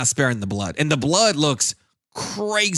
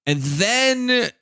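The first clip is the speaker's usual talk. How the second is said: normal talking voice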